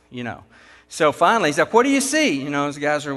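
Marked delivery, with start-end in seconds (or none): 1.7-2.4 insistent demand. Retold or imitated. Foreman pressing the crew again.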